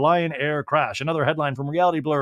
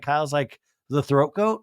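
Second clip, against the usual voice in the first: ordinary delivery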